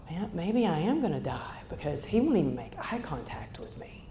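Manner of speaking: soft unvoiced whisper of fear; whispered dread. To herself in the ambulance, a scared whisper. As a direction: breathe it out as a frightened private thought. Inner thought of fear, near dying.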